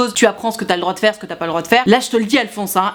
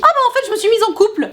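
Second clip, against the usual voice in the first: much higher, breezy mock girl voice; 11 semitones higher, faster than usual. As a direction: parody her airy excuse, pitched way up. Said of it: "qu'est-ce qu'elle te dit?".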